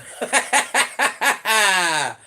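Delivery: high, loud burst of laughter; loud real cackle. Real, not acted. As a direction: cackle in quick bursts, ending on a long note. Laughs at mountain yeti comment.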